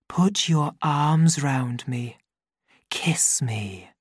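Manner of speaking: slow, sinking to a breathy whisper; sleepy whisper. A narrator's reading. Lenina murmuring to the savage, sinking to a whisper. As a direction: let it fade into a drowsy whisper. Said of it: "let her voice sink into a sleepy whisper".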